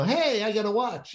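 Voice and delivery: a quick high character line for Vinny; lightly voiced Vinny quip; Vinny's voice. Author previews the character Vinny, lightly voiced. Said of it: "or Vinny".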